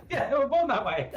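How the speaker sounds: high, loud, through laughter; laughing. Real, not acted. Agreeing with co-host's joke, laughing. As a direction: crack up while agreeing.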